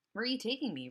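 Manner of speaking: ordinary delivery, nothing marked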